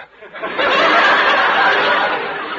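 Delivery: a big, high burst of real laughter; hearty laugh. Real, not acted. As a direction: laugh out loud at your own joke. [name] laughs at his own joke.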